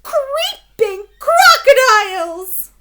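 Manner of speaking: storyteller bursting out as Cass; excited exclamation. Acted. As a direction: shout it out thrilled and amazed. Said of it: "said Cass".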